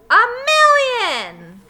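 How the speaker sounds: huge, high, drawn-out yell. Acted. Terrence yelling as he kicks the ball away, huge yell. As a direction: bellow it at the top of the lungs. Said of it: "yelled Terrence".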